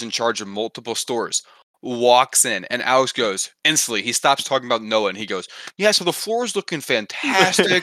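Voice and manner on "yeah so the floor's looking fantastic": high, put-on upbeat report; breezy cover-up. Coworker covers up before the corporate boss, breezy cover-up. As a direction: imitate someone acting like nothing's wrong.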